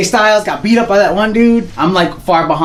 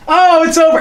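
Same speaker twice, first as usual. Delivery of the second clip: mock surprise; 11 semitones higher than usual. Short expensive UFC fight.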